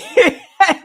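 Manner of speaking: loud real laugh; burst of laughter. Real, not acted. Vlogger laughs at [name] mistaking [name], real laughter.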